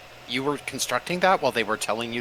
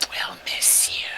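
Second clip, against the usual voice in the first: whispering; 4 semitones lower, 3 dB quieter, slower than usual. Acted. Enemies whisper farewell.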